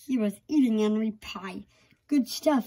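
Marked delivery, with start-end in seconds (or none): 2.1-2.6 mumbled through a mouthful; mouth full. Acted. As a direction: talk around a big bite. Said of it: "he mumbled through a mouthful".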